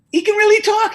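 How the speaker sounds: very high, loud acted astonishment; amazed. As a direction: burst out with the unbelievable news. Joke's man tells owner, amazed.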